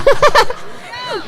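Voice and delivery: short sheepish laugh; embarrassed laugh. Real, not acted. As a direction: laugh off the mistake. Laughs at own slip.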